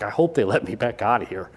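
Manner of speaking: low, soft aside to himself; retold private worry. Preacher recalls thinking behind the jail doors, muted worry. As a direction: think it under your breath, low.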